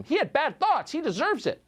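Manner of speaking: high-pitched scornful imitation; hammed-up self-righteous verdict; mock outrage. Mimics those refusing to defend him.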